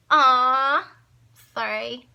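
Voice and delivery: a loud, high "aw" then a sheepish sorry; surprised aw. Retold or imitated. Finding the keys in the window, loud surprised aw then sorry. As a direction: caught out, suddenly sheepish. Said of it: "And I was like".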